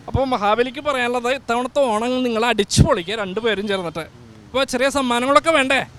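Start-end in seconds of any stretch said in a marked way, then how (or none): none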